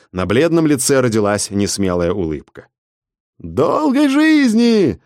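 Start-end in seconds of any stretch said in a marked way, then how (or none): 3.4-5.0 high, slow drawn-out cheer; joyful roar. Acted. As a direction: roar it like a crowd. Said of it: "радостно взревела толпа". (радостный рёв толпы)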